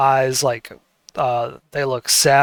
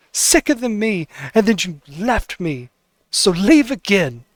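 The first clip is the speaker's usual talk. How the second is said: a daughter's furious rant, pitched up; bitter shouting at her dad, higher throughout; yelling at father; 7 semitones higher than usual. Acted. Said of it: "she yells at her father".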